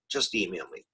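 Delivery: usual speaking voice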